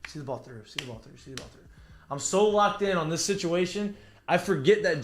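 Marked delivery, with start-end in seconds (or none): 0.1-1.6 quiet repeated self-talk, almost to himself; softly repeated mantra under the breath; inner voice. Retold or imitated. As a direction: mutter it in your head like a mantra. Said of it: "So I'm in my head".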